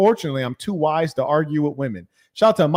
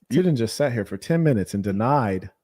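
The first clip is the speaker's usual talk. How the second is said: ordinary talk, nothing marked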